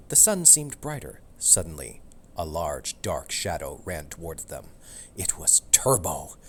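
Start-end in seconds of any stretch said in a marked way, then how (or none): none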